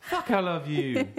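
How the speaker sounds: slow, high, drawn-out South African; Stephen Hudson. Acted. Impersonating him for the laughing friend, slow high South African accent. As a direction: stretch it out in hammed-up South African. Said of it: "You sound like him already".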